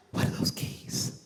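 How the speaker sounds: breathless whispered question, slow; gasp, then whisper it; hushed gasp. Retold or imitated. Wife quoted, stunned.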